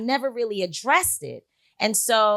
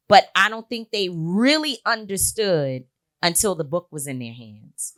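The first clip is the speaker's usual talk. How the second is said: ordinary talk, nothing marked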